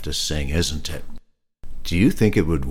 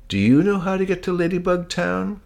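ladybug voice; 13 semitones higher, 3 dB louder than usual. Acted. Ladybug asks hummingbird.

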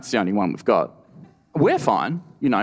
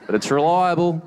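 mocking claim; 7 semitones higher, 3 dB louder than usual. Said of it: "It's not cheap".

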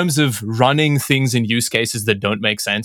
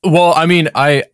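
ordinary talk, nothing marked

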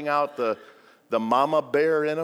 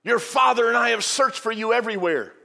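higher, louder scolding mother; a hint of a scolding mom; mock scolding; 7 semitones higher, 4 dB louder than usual. Mary scolding Jesus for running off. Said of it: "Mary scolded him, saying".

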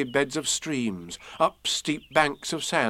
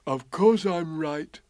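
Pooh; 4 semitones higher, slower than usual. A narrator's reading. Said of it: "said Pooh".

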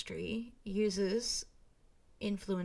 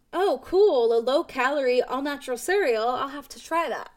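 higher, louder, eager inner voice; eating disorder voice; 3 semitones higher, 7 dB louder than usual. Retold or imitated. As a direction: play an excited temptation, pitched up and loud. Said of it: "That was my eating disorder voice talking".

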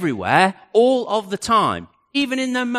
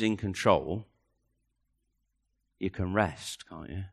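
lowered, gentle and soft; soft and hushed; 11 semitones lower, 9 dB quieter than usual. Acted. Preacher to congregation, hushed and intimate. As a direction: say it almost under your breath. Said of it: "repeats the line slowly".

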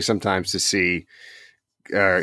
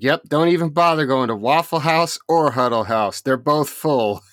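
higher-pitched mock fed-up warning; imitated exasperated woman; 7 semitones higher than usual. Local woman warns waiting diners, imitated exasperation. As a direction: warn others off in a put-on higher voice. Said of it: "this lady comes storming in".

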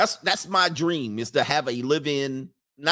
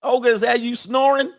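high, louder call to someone asleep; mock calling out; 9 semitones higher, 5 dB louder than usual. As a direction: call out in mock disbelief at the noise. Calling to imagined snoring maid.

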